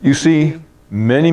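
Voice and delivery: ordinary delivery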